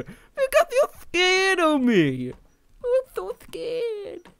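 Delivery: high, slow mocking whine; mock the owner's fear; mock scared voice. Gamer mocking being scared, high and slow.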